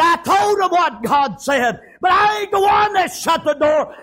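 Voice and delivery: high, shouted self-defence; loud, stretched-out insistence; emphatic protest. Acted. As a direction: protest it at full preaching pitch. Noah answers his pleading wife.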